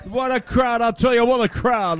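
big, high-pitched lounge-host welcome; showman emcee opening. Acted. Host greeting the live crowd with a lively emcee welcome. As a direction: work the room like a Vegas emcee.

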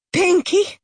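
high, loud desperate call; shouted name. A narrator's reading. Mother shouting at her fainted daughter, desperate call. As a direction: shout her back to waking. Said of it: "she shouted".

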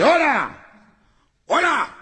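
high-pitched phone holler; comic caller's high hello; calling out hello. Acted.

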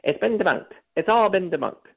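high, nasal mock of a smug little reporter. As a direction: mimic a wiry little reporter's nasal whine. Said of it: "And he repeats himself".

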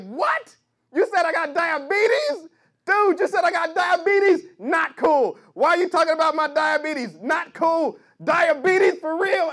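loud indignant comic; offended yell. Retold or imitated. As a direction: shout back, deeply insulted. Offended comic yelling back.

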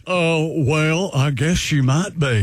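slow Southern drawl; Southern accent. Acted. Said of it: "I'm using a Southern accent".

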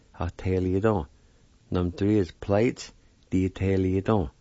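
usual speaking voice

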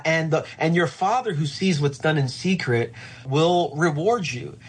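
usual speaking voice

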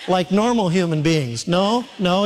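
ordinary delivery

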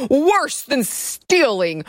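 high, drawn-out mocking headline read; mock outrage. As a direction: stretch it out in hammed-up horror.

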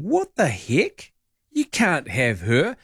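drawn-out, mock-outraged objection; slow indignant protest. Real, not acted. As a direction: stretch it out, you can't have her. Objects to Australia claiming singer.

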